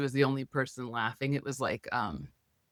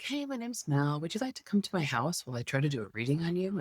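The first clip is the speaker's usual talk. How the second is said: breezy, slightly too-eager friendliness; chirpy self-introduction; 3 semitones higher, 4 dB quieter than usual. Retold or imitated. Approaching a mom at a meetup, a mock-chirpy invitation. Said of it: "but I was like".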